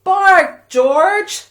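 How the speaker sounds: loud high call, bark george; loud rallying command; calling the dog. Pretend.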